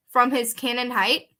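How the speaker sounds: ordinary talk, nothing marked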